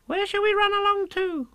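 very high, piping question; childlike Roo. A narrator's reading. Roo asking Kanga where to go, very high and piping. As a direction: squeak it as little Roo.

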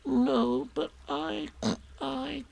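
tiny, high, soft, broken squeak; Piglet faltering. A narrator's reading. Piglet confesses to Eeyore, small halting miserable voice. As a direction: falter with long pauses, fading almost away.

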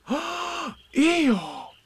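high exclamation after a gasp; high-pitched, amazed call; shocked chorus. A narrator's reading. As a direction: cry out the name in astonishment. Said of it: "said everybody".

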